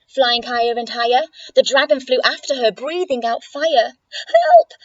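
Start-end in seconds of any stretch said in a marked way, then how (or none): 4.3-4.7 very high, loud cry for help; piercingly high, panicked shout. Acted. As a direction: squeal for rescue as the frightened witch. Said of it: "cried the witch".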